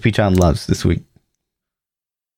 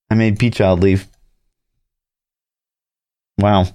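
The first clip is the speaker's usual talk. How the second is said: ordinary delivery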